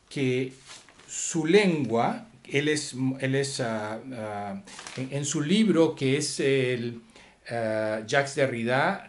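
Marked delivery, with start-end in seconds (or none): none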